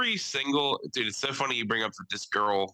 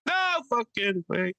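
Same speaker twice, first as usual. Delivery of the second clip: much higher, hammed-up Italian comic voice; old Italian men; 11 semitones higher than usual. Host acting out Sebastian's joke about old Italian men, pitched high. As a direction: ham up an Italian comic's bit. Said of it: "one joke where he's like".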